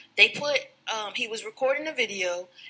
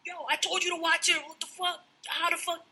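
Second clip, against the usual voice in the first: higher, frantic Chris yelling at his crew; mock panic; 7 semitones higher than usual. Chris yelling at his crew about the girl, frantic and higher. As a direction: voice him losing it. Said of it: "So he's spazzing".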